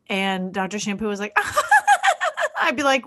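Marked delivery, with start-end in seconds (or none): 1.4-2.6 shrill mock giggle, very high; weird high-pitched giggle. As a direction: imitate an inappropriate giggler's high cackle. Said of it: "this weird high-pitched giggle".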